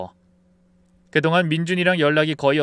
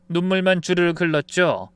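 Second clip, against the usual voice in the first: usual speaking voice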